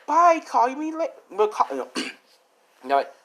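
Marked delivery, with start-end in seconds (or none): 0.0-1.7 high-pitched imitation of a girl; put-on girlish send-off; girl's goodbye. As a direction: call out a farewell in a high mock-girly voice. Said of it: "the other girl's like".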